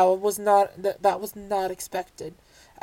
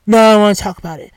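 louder, fast mock refusal; imitating him; 11 dB louder, faster than usual. Vlogger imitates a friend brushing off her question, mock-quick. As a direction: toss off his refusal in a hurried mock voice. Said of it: "He was like".

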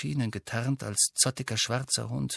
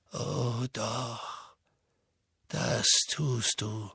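much softer, slow, quiet certainty; even calmer; 6 dB quieter, much slower than usual. A narrator's reading. Dumbledore answers Harry's scream even more calmly. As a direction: drop almost to a hush. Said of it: "sagte Dumbledore noch ruhiger".

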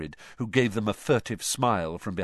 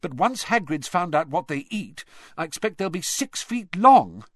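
exasperated; 8 semitones higher, 5 dB louder than usual. A narrator's reading. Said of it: "in an exasperated voice".

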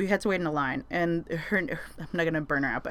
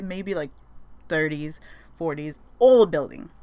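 ordinary delivery

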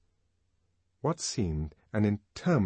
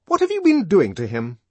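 high, loud, sharp nurse; fierce demand; 10 semitones higher, 11 dB louder than usual. A narrator's reading. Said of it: "she demanded fiercely".

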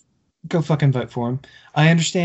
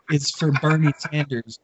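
ordinary talk

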